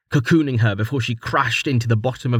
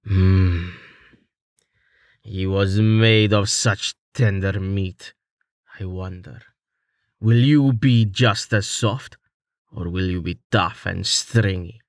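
slow, savoring monster voice; menacing villain; 2 semitones lower, slower than usual. A narrator's reading. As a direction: drawl it out hungrily.

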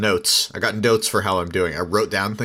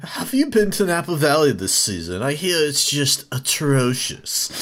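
higher-pitched mock Oxford snob; pretentious British accent; 5 semitones higher than usual. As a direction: make snobbish small talk in an Oxford accent. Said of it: "that sounds pretentious as all fuck".

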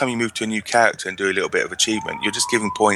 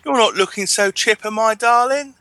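high, loud, hammed-up Gilnean lilt; mock Worgen accent; 4 dB louder than usual. Mocking the Worgen quest giver's awful accent. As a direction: sing it out like a posh werewolf questgiver. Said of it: "is the awful accents".

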